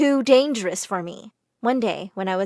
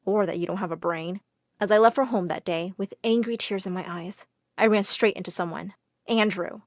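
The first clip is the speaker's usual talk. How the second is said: usual speaking voice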